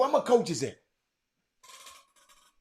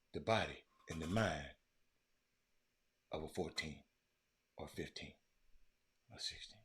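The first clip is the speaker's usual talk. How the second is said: hushed sincere; 11 semitones lower, 16 dB quieter than usual. Real, not acted. Earnest point about teens.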